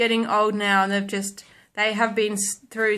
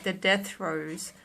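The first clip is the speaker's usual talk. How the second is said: usual speaking voice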